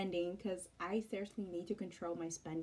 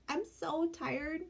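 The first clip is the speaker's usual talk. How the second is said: sleepy whine; 9 semitones higher, 5 dB louder, slower than usual. Retold or imitated. Speaker too sleepy to help.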